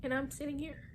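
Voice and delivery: tearful, voice breaking; crying. Real, not acted. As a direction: cry through the words. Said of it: "crying because I don't".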